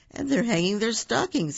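snarling Grinch. Acted. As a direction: sneer it out as the Grinch. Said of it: "he snarled with a sneer".

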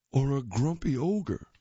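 ordinary delivery

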